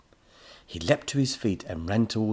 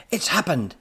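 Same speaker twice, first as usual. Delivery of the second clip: high, loud cry of triumph; excited shriek; 9 semitones higher, 6 dB louder than usual. A narrator's reading. Professor at flashing scanner, excited shriek. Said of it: "he shrieked".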